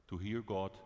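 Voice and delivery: ordinary talk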